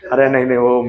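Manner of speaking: amused protest broken up by laughter; real chuckles between the words; laughing. Real, not acted. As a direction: laugh it off modestly while speaking. Modestly laughs off a student's remark.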